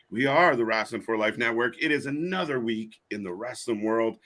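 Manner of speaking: ordinary talk, nothing marked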